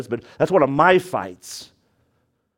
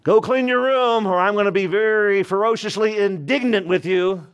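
high, hammed-up scolding with a laugh; mock scolding parent; 7 semitones higher than usual. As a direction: play the pompous parent, half laughing. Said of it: "Use that a lot with your kids".

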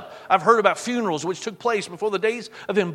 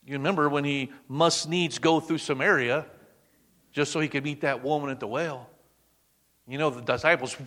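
ordinary talk

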